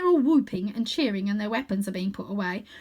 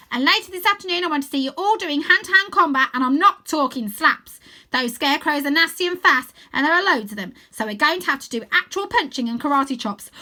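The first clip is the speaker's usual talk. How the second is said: put-on higher, louder commanding girl voice; drill-sergeant chief; 7 semitones higher, 8 dB louder than usual. A narrator's reading. Stella commands her girls to train hard, loud and bossy. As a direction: bark orders at the troops.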